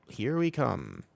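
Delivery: ordinary talk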